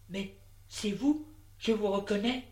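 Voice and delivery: he can't believe it's her; insisting. A narrator's reading. Vincent insists, surprised.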